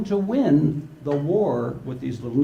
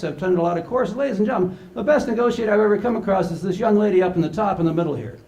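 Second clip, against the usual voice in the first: ordinary talk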